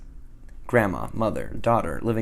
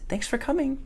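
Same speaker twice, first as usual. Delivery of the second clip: much higher, a lightly voiced little girl; little girl's voice. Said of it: "and says".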